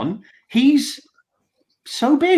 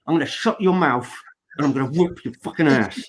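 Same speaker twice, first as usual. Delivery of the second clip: lower, hard-man threat; tough man's version; 6 semitones lower than usual. Shown as a demonstration. How a man would say the threat, low and hard. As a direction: growl it like a real tough guy. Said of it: "if a man says it".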